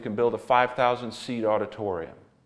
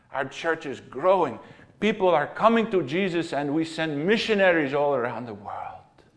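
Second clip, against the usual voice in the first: a lightly voiced Russian man, earnest and higher; thick Russian accent; 4 semitones higher than usual. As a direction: retell the Russian pastor in a mild Russian accent. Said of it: "said in a thick Russian accent".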